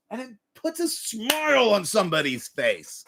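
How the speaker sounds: ordinary talk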